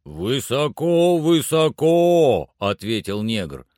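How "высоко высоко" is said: long drawn-out call, pitched up. A narrator's reading. Climber answers from high in the tree, calling out. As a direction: stretch each word so it carries down.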